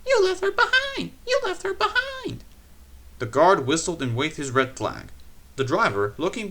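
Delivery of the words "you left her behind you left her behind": high, loud wailing protest; very high complaining coaches; alarmed coaches. A narrator's reading. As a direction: cry out as frightened coaches.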